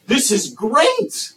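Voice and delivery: high, slow, gushing delight; excited. Acted. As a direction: stretch out great with mock joy. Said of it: "you should be saying in your heart".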